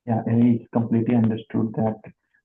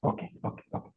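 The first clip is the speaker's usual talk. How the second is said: whispering; 12 dB quieter, much faster than usual. Real, not acted. Quiet thanks before signing off.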